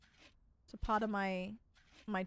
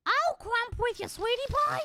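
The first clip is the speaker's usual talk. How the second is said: Marge turned Toad impression; 3 semitones higher, slower than usual. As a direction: squeak it like a toadstool character. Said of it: "Marge Simpson is just Toad".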